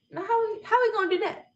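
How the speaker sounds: high, eager, laughing retold question; excited question. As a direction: bubble over with eager curiosity. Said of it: "Like, I'm excited".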